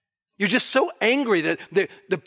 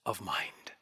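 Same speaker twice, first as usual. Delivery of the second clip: whispered; 10 semitones lower, 14 dB quieter than usual. Real, not acted. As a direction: trail off into a whisper. Quote fades away.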